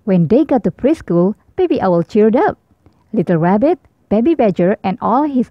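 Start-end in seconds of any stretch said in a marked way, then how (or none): none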